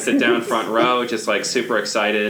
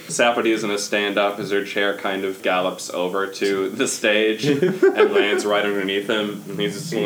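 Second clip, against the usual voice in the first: usual speaking voice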